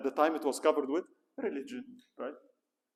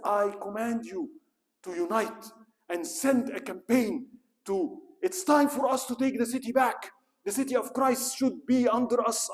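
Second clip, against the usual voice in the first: commanding papal decree; 8 semitones higher, 6 dB louder than usual. Retold or imitated. Pope commanding the kings to take Jerusalem, in a loud booming voice. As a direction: order them to march.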